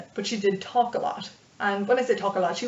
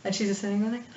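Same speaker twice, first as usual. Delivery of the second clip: ordinary talk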